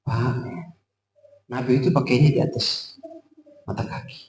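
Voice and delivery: soft gentle advice. Shown as a demonstration. Preacher showing how to advise a man kindly, soft and hushed. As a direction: ease the correction in quietly. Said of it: "Antum bilang".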